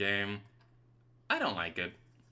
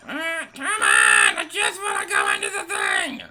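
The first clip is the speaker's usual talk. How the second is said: loud, high, whiny cry; frustrated high shout; 6 semitones higher, 11 dB louder than usual. Real, not acted. Gamer wailing at the game for blocking him. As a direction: wail it in frustration.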